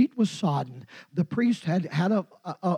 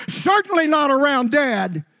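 usual speaking voice

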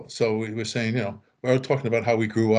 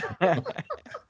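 real laugh; 5 semitones higher, 3 dB quieter than usual. Real, not acted. Laughing mid-quote.

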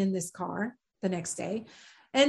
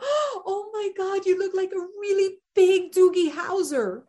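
raised-pitch, gushing astonishment; star-struck amazement; 6 semitones higher than usual. Retold or imitated. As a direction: act out being floored by a celebrity. Star-struck quote after a gasp.

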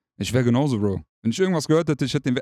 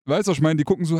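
usual speaking voice

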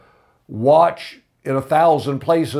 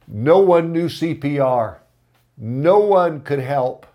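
ordinary delivery

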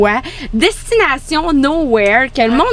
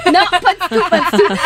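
higher, laughing no; laughing denial; 7 semitones higher than usual. Real, not acted. Guest laughs off the co-host's teasing about being paid. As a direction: deny it through real laughter.

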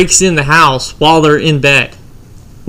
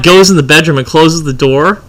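ordinary talk, nothing marked

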